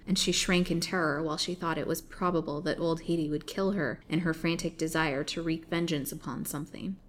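ordinary delivery

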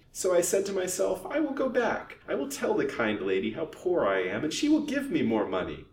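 put-on whining old crone, rising high. A narrator's reading. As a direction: wheedle a benefactor in a whine. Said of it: "whined Haidee".

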